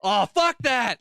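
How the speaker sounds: loud, high heckle at the screen; imitating a crowd's outburst; yelled audience reaction. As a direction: yell it out like a rattled moviegoer. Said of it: "like, yelling out loud".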